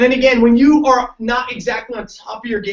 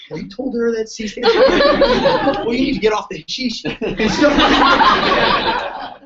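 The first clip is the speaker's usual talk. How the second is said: high, laughing scorn; mock it through a laugh; 6 semitones higher, 6 dB louder, slower than usual. Real, not acted. Speaker laughs off the C-for-chiropractic claim, laughing.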